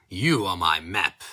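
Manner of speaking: usual speaking voice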